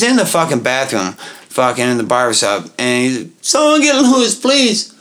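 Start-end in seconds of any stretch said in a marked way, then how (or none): 3.4-4.9 high, pleading call with a laugh; whiny calling out. Retold or imitated. Drunk friend calling from the barbershop bathroom for Lewis, high whine. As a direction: call for help like a helpless drunk.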